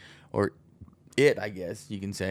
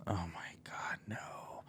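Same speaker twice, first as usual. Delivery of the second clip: breathless whispered dismay; horrified whisper; 4 semitones lower, 16 dB quieter, slower than usual. Retold or imitated. Parent whispers in shock seeing kid on TV. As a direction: whisper it like watching disaster.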